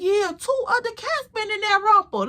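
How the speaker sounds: high imitated jeer; hammed-up high teasing; mocking disbelief. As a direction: pitch it up as a clowning man. Acted man mocking the romper.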